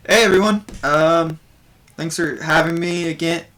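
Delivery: bard doing stand-up. Acted. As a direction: Buvor working the crowd as a nervous comedian.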